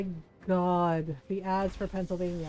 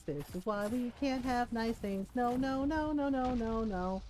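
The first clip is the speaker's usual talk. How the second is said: ordinary delivery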